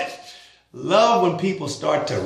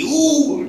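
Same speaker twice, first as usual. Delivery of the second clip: high-pitched mock-flustered "ooh"; mock tizzy; 12 semitones higher than usual. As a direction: squeal an exaggerated ooh, as if worked up. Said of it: "into a big emotional tizzy".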